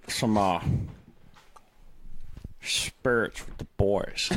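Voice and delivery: lazy country drawl. Shown as a demonstration. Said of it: "as a redneck".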